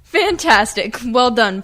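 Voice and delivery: usual speaking voice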